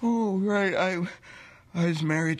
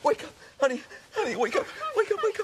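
high-pitched, urgent pleas to wake up; panicked, rousing a sleeping partner; frantic waking; 13 semitones higher, faster than usual. Acted. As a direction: shake them awake with your voice.

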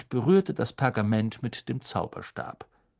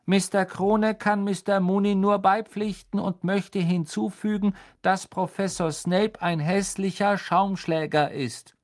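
higher, louder cheeky tone; mocking map voice; 9 semitones higher, 4 dB louder than usual. The map insulting Snape in a cheeky voice. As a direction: read it like a prank note.

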